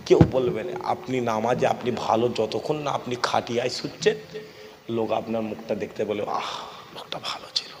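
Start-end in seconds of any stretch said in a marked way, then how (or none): 6.2-7.7 softer whispered sigh of the mourners; hushed mourner's sigh; whispered mourning. Retold or imitated. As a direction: whisper it like people at the funeral cot. Mourners' words at the funeral cot.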